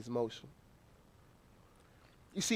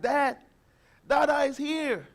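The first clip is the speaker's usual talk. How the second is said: lightly voiced child, a bit higher; little son calling; 5 semitones higher than usual. Toddler greeting his dad at the stairs, lightly voiced. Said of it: "he was like".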